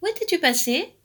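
high, worried question. A narrator's reading. Aunt questioning late-returning Alaric, worried. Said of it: "s'inquiéta tante Zinnia".

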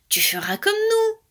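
high, drawn-out insistence; insistent. A narrator's reading. Pirouette urging anxious Alaric, insistent. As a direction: stretch it out, coaxing and high. Siblings reassuring anxious rabbit.

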